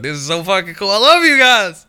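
imitating a gushing actor, rising to a loud high cry; excited outburst. Friend imitates the actor shouting his love, excited. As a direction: blurt it out loud and high, overjoyed.

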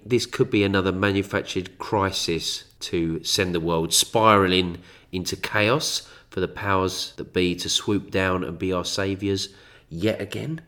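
usual speaking voice

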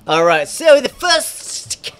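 high, drawn-out put-on character voice; drunken, hammed-up lisping impression, slow and loud; Toulouse. Host starting the actor segment as Toulouse, high lisping voice. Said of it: "how about I just do Toulouse".

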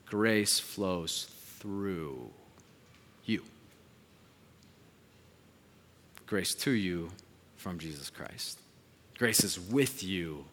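very slow, soft blessing; closing benediction. Acted. Pastor blessing the congregation at the close, slow and soft. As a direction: bless them gently, word by word.

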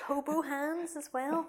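put-on slow, lightly German-accented phrase; hint of a German hobo. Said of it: "sounds like a German hobo".

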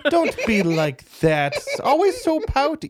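high, loud teasing coo. Acted. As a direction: tease her like a fond big sister.

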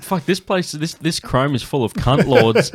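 imitating the mate through laughter; a giggling mock drunk voice; laughing impression. Mocking the Finnish mate's swearing, laughing through it.

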